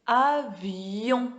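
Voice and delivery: AVION slowly, with a drawn-out y before on; glided yon ending. Shown as a demonstration. Teacher repeating for the class, AVION with lingering yon glide. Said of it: "On doit faire le ye".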